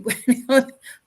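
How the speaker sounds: loud burst of laughter; amused laugh. Real, not acted. Mocking the Kevin Samuels look, laughing. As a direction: laugh out at the joke.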